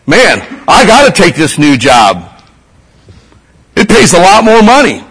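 a hint of a gullible convert; mock naive believer. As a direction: lightly play a gullible believer chasing money. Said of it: "Some people are like".

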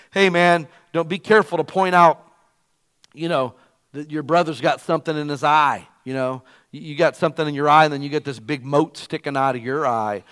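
preacher quoting Jesus to the congregation, emphatic; preaching a scripture quote. Acted. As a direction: hammer the verse home to the crowd. Preaching Luke six quote.

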